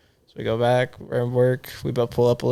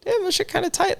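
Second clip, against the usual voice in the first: high-pitched stoned voice; 4 dB quieter than usual. Retold or imitated. Retold high reaction to weed.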